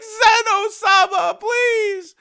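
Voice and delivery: high, slow crying plea; imitating a sobbing god begging for mercy; desperate plea. Kaioshin begging before his universe's erasure.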